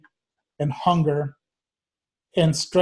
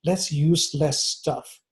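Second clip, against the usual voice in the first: slogan, slowly; 3 dB quieter, much slower than usual. Retold or imitated. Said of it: "I'm more like".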